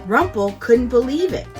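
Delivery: usual speaking voice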